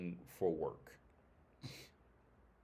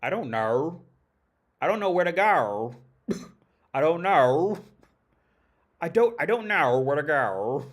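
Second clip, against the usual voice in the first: louder, higher mocking Australian accent, R on no; 5 semitones higher, 10 dB louder than usual. Mocking how Australians talk, in a hammed-up Australian accent. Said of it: "For example, they say".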